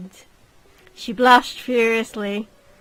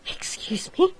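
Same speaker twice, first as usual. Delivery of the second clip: offended whisper; 8 semitones higher, slower than usual. Acted. Offended lady.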